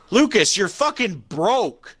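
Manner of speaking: high, incredulous put-down with a laugh behind it; mock scornful retort. Telling a friend who fears for his card, mocking scorn. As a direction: scoff at a broke friend's worry.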